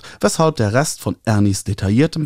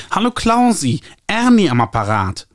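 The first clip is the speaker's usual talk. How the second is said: high, nasal, gushing publisher voice; 9 semitones higher than usual. A narrator's reading. Publisher Ernie calling the author, high nasal gushing. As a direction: trumpet it through your nose like an eager publisher. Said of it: "anstrengendes Näseln".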